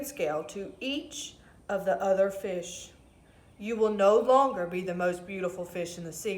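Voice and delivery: a hint of a deep old octopus. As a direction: speak like an ancient sea creature. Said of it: "with a deep voice".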